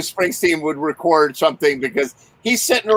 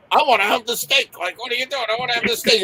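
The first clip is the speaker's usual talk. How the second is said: higher, whiny demand for steak; lightly voiced rocker whining for steak; 6 semitones higher, faster than usual. Friend mocking a bandmate's steak demand, higher whine. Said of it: "[name] was like".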